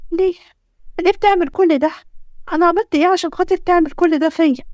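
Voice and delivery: high-pitched, tearful protest; crying aloud. Acted. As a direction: plead with him through tears, pitch climbing. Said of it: "فضلت تعيط بصوت عالي". (بعياط واحتجاج)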